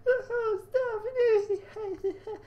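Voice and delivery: high falsetto sobbing, loud; a hammed-up wailing sob; crying maid. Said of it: "She began to cry again".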